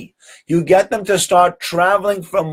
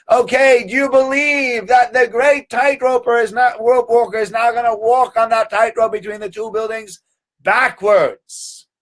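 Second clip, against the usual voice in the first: showman's call; 8 semitones higher than usual. Retold or imitated. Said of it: "He says".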